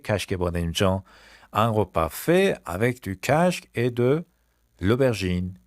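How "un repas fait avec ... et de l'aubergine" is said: Un repas fait avec du casque said slowly; walk through each word slowly; slow model. Shown as a demonstration. Teacher to class, slow model. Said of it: "slow model phrase".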